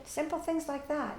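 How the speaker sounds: normal talking voice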